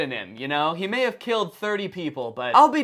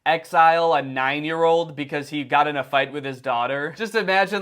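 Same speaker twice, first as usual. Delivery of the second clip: ordinary talk, nothing marked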